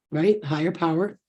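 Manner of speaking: usual speaking voice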